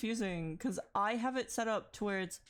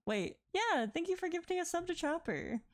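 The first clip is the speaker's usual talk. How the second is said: high-pitched thanks; 10 semitones higher than usual. Real, not acted. Thanking gifted sub.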